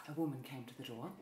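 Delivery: soft hurried aside to viewers; muttered aside. Real, not acted. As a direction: rush it under your breath before correcting. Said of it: "Sorry, a woman came to the door".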